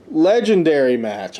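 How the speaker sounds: usual speaking voice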